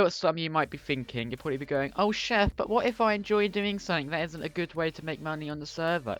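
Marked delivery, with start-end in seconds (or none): 1.9-6.2 lightly whiny imitated viewer; mock a whining viewer, a touch nasal-free but pleading; whiny accent. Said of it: "I've just put a whiny accent on".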